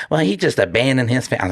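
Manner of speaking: mocking the critics. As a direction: toss off the family's complaint in a put-on voice. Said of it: "people would be like".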